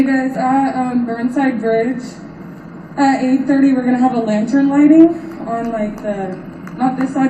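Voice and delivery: ordinary talk, nothing marked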